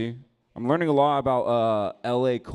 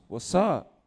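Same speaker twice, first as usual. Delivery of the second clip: higher, louder challenge back; mock challenge back; 9 semitones higher, 3 dB louder than usual. Other fool answering the challenge, higher.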